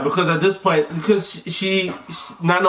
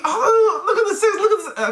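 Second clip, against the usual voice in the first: excited; 13 semitones higher than usual. Retold or imitated. Partner urging to see the sex.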